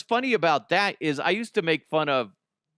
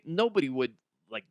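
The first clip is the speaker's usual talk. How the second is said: usual speaking voice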